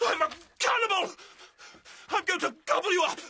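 high, fast, wild threat; manic threat. Acted. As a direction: spit it out like a madman. Father menacing his daughter.

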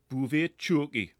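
put-on reads a French sign in a broad Geordie accent; a light Geordie twang on the foreign words. Joking to Claire, reading a roadside sign in Geordie. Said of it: "in a Geordie voice".

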